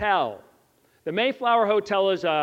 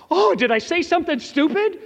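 panicked inner thought; 9 semitones higher, 7 dB louder than usual. Retold or imitated. Retelling his own panic at the mistake.

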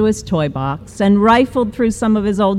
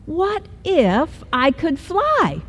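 high, soft, slow wondering voice; dreamy kitten; 8 semitones higher, 4 dB quieter, slower than usual. Acted. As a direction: wonder it aloud like a child. Kitten daydreams aloud.

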